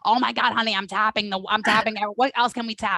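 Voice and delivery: a jittery fangirl tapping away her nerves; nervous fangirl character. Acted. Host playing a nervous fangirl character meeting her idol, flustered and high. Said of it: "that's one of my characters".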